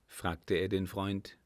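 plain reading voice

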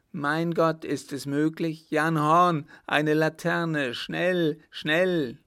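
loud, high, urgent cries for help; alarmed shouting. A narrator's reading. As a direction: shout orders in sudden alarm. Alarmed emperor shouts for a lantern. (aufgeregt und laut rufen)

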